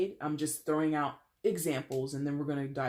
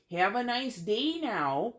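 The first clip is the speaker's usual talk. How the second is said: slow, higher-pitched mock Midwestern accent; 6 semitones higher, 4 dB louder, slower than usual. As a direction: exaggerate a cheery Midwestern farewell accent. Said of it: "driven up a wall hearing that accent".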